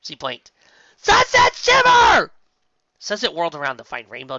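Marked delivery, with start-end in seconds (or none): 0.9-2.3 slow, high, loud shout; shouting full name. Acted. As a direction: bellow her full name. Rainbow Dash yells in face.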